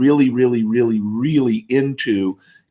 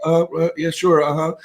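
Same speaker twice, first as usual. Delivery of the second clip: higher-pitched, noncommittal mumbled agreement; half-listening, pitched-up acknowledgements; distracted brush-off; 4 semitones higher than usual. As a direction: humour someone you don't want to talk to. Mimics man brushing off a talker.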